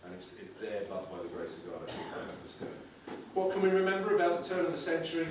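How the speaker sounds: mock-serious documentary voiceover; solemn documentary narration. Mock documentary narration, lower and softer.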